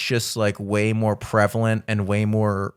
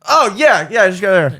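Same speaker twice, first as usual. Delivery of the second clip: flustered cover-up; 8 semitones higher, 7 dB louder than usual. Retold or imitated. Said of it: "It's like".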